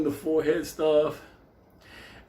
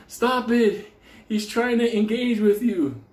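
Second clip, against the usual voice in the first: mock urgent plea; 8 semitones higher, 3 dB louder than usual. Priest pulling Benny off.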